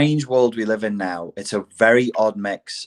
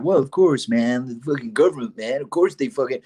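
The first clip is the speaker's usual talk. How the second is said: mock ironic know-it-all, pitched up; sneering hipster; 3 semitones higher than usual. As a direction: scoff it like a jaded hipster, imitating. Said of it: "everybody kind of sneers and is, like, hipstery and ironic".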